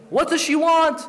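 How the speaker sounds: annoyed tyrant. Acted. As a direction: snap the question like an irritated ruler. Yazid demands about the girl.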